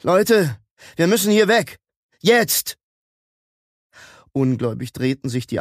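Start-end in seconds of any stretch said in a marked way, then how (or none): 0.0-2.8 high, loud call to get moving; rally everyone with a raised, urgent voice; urgent shout. A narrator's reading. Like warns the others about the approaching fire, urgent shout.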